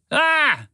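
short, high, loud shriek of fright; startled high scream; panicked scream. A narrator's reading. Spaceship hearing a voice behind her, panicked scream. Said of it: "Spaceship panicked".

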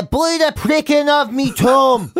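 high, slow Macbeth in a hammed-up local accent; mock local-accent Shakespeare. Actor doing Macbeth at the Abbey, hammed-up local accent. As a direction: drag out the verse in a broad regional accent. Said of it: "it's a different show".

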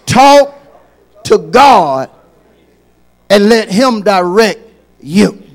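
loud, high, pleading preacher's cry; full-force preaching. Acted. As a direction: preach it hard, urging the church.

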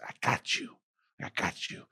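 soothe, fading to a whisper; reassuring. Retold or imitated. Server reassuring.

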